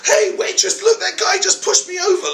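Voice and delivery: high, loud whining tattle; whiny complaint. Retold or imitated. Teller mimics the Dutch guy complaining to staff, high whine. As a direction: whine like a wounded snob. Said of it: "he sort of goes".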